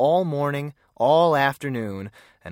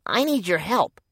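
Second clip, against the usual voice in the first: lightly voiced young boy, timid; a shy little kid asking for help; small boy's voice; 6 semitones higher than usual. Small boy Clarence speaks.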